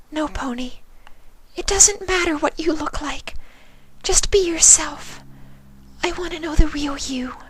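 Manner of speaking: high, breathy whisper of a timid pony; gentle, shy, barely daring to ask; Fluttershy's voice. A narrator's reading.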